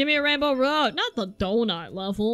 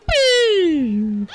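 loud, drawn-out falling boo; high holler sliding down; calling out; 5 semitones higher, 6 dB louder than usual. Real, not acted. Gamer jeering in the race, drawn-out boo.

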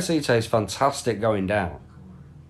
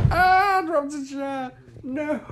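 much higher yelp of dismay; startled squeal. Real, not acted. Streamer yelping as he drops a chair. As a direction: squeak out the accident.

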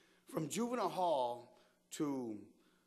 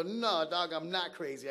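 loud, raised-pitch protest; acted loud retort; 4 semitones higher, 8 dB louder than usual. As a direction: push back hard, imitating your indignant self.

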